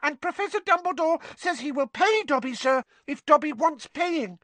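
very high, squeaky elf voice; excited house elf. A narrator's reading. Dobby tells Harry of his pay, squeaking. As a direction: squeak proudly like a little elf.

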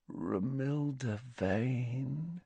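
hushed, lovestruck, very slow; soft. A narrator's reading. Lovestruck Ron reveals her name to Harry, softly. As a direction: breathe the name out slowly, adoringly. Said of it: "said Ron softly".

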